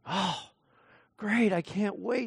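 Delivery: quietly mock the promise you don't believe; sarcastic child. Child mocks the empty promise.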